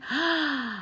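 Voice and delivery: a sharp, touched intake of breath; real touched gasp; moved gasp. Real, not acted. Moved by viewer's AI quote.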